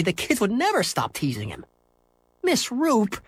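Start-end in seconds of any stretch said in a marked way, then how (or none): 2.4-3.2 high-pitched, pleading call; a desperate schoolboy appealing to teacher. Acted. Henry begging his teacher, desperate and high. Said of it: "he said desperately".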